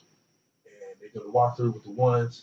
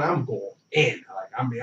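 usual speaking voice

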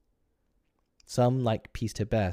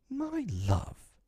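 doting. Acted. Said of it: "husband's reply".

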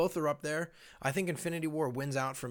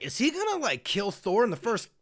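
shocked disbelief; 7 semitones higher, 5 dB louder than usual. Retold or imitated. Retelling shock at film opening.

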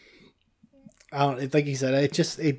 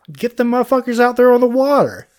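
loud and high, worked up; excited loud outburst; 12 semitones higher, 9 dB louder than usual. Real, not acted. Podcast host telling cohost about the killings, excited and loud. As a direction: get fired up about how crazy they were.

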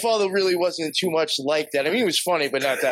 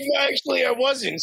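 whiny protest; 11 semitones higher than usual. Retold or imitated. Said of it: "And I'm like".

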